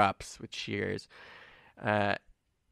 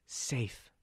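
normal talking voice

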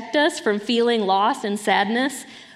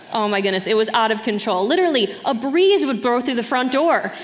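normal talking voice

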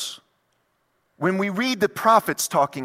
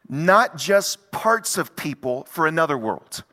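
ordinary delivery

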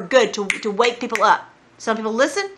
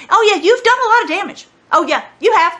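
mock agreement; 8 semitones higher, 4 dB louder than usual. Mocking reply to the boaster.

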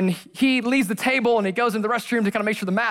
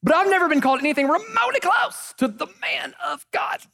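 high, raised-voice declaration; emphatic comic protest; 9 semitones higher than usual. Real, not acted. Preacher comparing himself to Elijah, high emphatic protest. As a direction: pitch it up high in mock disbelief.

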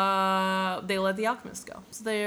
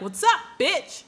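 mock thug greeting; 10 semitones higher, 6 dB louder, slower than usual. Voices thieves confronting Santiago.

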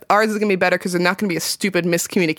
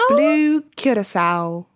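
mock British; 3 semitones higher, slower than usual. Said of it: "Are we British?".

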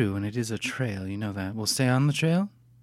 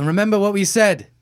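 raised, higher warning call; stern warning; 10 semitones higher, 7 dB louder than usual. Acted. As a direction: call the warning after him. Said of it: "I say".

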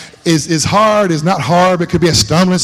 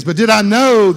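usual speaking voice

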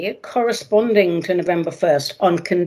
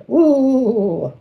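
spooky ooh; 5 semitones higher than usual. Acted. Ghostly ooh about the otherworld.

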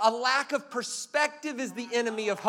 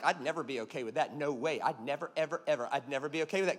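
lower, softer mock of a know-it-all; lowered, hushed parody of smug certainty; 9 semitones lower, 6 dB quieter than usual. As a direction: imitate someone sure of themselves, low and soft. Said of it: "be very careful of saying things like".

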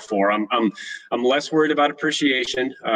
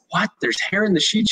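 high, startled question; suddenly hear the news is gross; shocked disbelief; 9 semitones higher than usual. Retold or imitated. Host hearing mom found hair in sheets, shocked.

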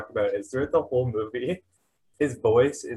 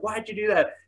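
high-pitched mock yell; mock scream; 10 semitones higher than usual. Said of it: "she's screaming at Denny".

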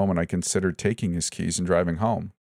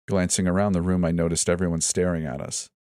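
plain reading voice

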